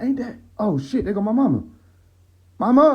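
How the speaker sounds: high-pitched double take, then calling out; surprised recognition. Acted. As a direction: act out suddenly spotting your mother. Spotting mama across the Walmart.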